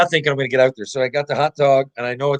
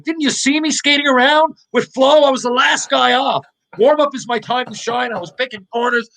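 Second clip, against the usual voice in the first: high, louder incredulous boast; acted-out indignation, pitched way up; incredulous protest; 11 semitones higher, 3 dB louder than usual. Player telling teammate warm-up is his time to shine, incredulous protest.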